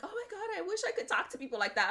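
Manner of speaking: squealed high "Oh my God", then quick and awed; gushing manager. Retold or imitated. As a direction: play the manager, half-envious and thrilled. Manager gushing in retold scene.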